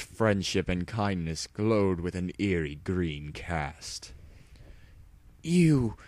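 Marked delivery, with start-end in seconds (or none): 5.4-6.0 high, loud, spat-out accusation. Acted. Hero confronting villain, spat angrily. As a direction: spit the word at your enemy. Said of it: "Dash spat".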